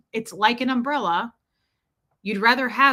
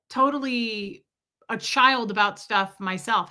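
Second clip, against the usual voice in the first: usual speaking voice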